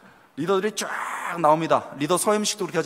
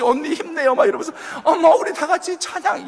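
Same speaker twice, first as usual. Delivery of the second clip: higher, louder rallying cry; mock cheering; 10 semitones higher, 5 dB louder than usual. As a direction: cheer your friend on. Friends cheering her on. (힘내라고 응원하는 말투)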